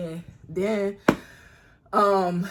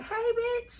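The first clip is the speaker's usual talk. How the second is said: high squealed "Hey, bitch"; much higher, girly greeting; excited greeting; 14 semitones higher, 4 dB quieter than usual. Retold or imitated. Greeting her friend, voiced.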